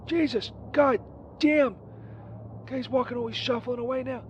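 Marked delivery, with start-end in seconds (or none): none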